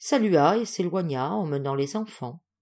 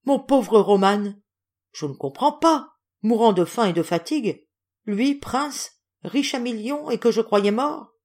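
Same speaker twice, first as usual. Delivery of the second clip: moved exclamation; 4 semitones higher, 4 dB louder than usual. A narrator's reading. General moved by friend's plight.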